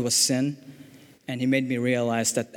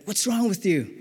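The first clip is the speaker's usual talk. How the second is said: imitating an angry shout, pitched well up; retold shout; 8 semitones higher than usual. Angry older brother grabbing his brother, retold mild shout. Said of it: "I just shouted".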